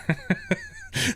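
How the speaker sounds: a sudden squeaky burst of laughter; high giggle. Real, not acted. Streamer giggling helplessly at his trolling chat. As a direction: break into a high-pitched giggle. Chat keeps saying Yorkshire.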